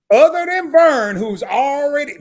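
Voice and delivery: single out "Vern" with slow, loud emphasis; drawn-out, emphatic "already"; pointed emphasis. Real, not acted. Pointedly singling out Vern before warning.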